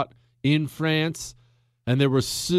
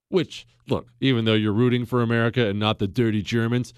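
ordinary talk, nothing marked

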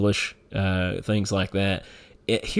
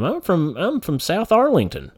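high-pitched, put-on answer about a hometown; mock fib; 9 semitones higher than usual. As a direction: toss out a playful lie in a raised voice. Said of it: "just lying to 'em".

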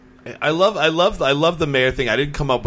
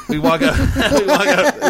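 much higher, laughing through the words; 12 semitones higher, 5 dB louder than usual. Real, not acted. Telling a friend about the concert, laughing. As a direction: break into laughter as you start the story.

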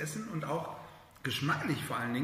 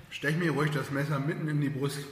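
words muffled by a mouthful; mouth full; 3 dB louder than usual. Real, not acted. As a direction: talk while still chewing. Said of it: "Jetzt muss ich mit vollem Mund sprechen". (mit vollem Mund sprechend)

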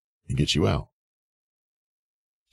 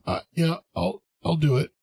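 much higher, stammering mock panic; mock panicked stammer; 13 semitones higher than usual. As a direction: squeak it out like a cornered newbie giving in. Panicked newbie caving to seller.

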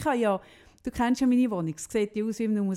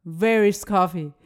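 nachgeäffte Putzfrau, lauter; 2 semitones lower, 8 dB louder, slower than usual. Retold or imitated. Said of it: "Sie so".

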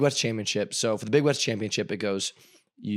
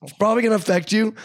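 high, louder voicing of Dalton; lightly playing Dalton, pitched up; teammate voice; 10 semitones higher, 5 dB louder than usual. Quoting teammate Dalton's old booster, pitched up and louder.

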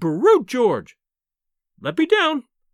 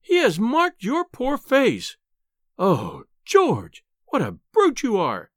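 high-pitched, indignant scolding; exasperated wife, dragging out the key words; furious wife; 6 semitones higher than usual. A narrator's reading. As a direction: scold your husband, patience at an end. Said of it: "was in a furious temper".

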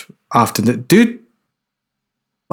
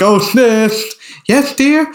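high mock old lady; 10 semitones higher, much slower than usual. Showing how the actor switches voices, high old-lady imitation. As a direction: flip up into a sweet old-nanny pitch. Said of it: "when he's going between the two".

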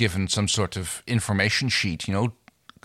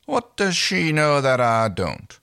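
slow, higher puzzled inner voice; inner thought; 4 semitones higher, slower than usual. Acted. Crichton's inner thought, read aloud.

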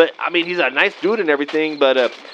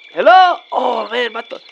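impatient; 4 semitones higher, 4 dB louder than usual. Acted. Nobody answers.

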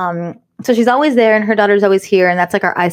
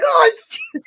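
high, laughing "oh shoot"; embarrassed laugh. Retold or imitated. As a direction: caught out, laugh it off. Mother caught out, embarrassed.

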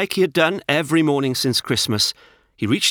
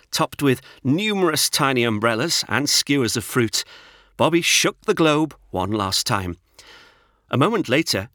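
usual speaking voice

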